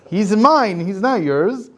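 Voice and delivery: loud, high claiming shout; possessive claim. Acted. Satan claiming the soul before the tribunal, loud. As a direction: stake your claim on him.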